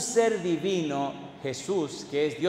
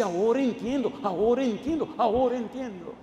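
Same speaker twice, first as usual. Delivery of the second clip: higher-pitched imitation of weeping speech; mock tearful; 5 semitones higher than usual. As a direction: imitate someone tearfully repeating a realization. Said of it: "vino llorando y me dice". (imitando un llanto, con voz aguda)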